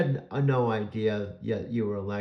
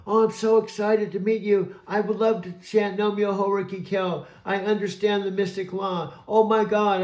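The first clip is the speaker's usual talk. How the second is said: squeaky high, loud, over-eager chirping; breathless mock-excited robot fan; gushing Alexa impression; 10 semitones higher, 7 dB louder than usual. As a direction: mimic a gushing, overexcited assistant. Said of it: "you were saying in the background".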